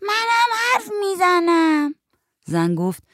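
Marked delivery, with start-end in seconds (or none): 0.0-1.9 put-on high, slow little-kid holler; child yelling. A narrator's reading. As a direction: yell from the other room like a small child. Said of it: "آتی از توی اتاق داد زد". (داد کشیده با صدای نازک بچه)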